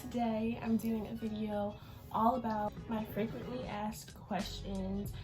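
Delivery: usual speaking voice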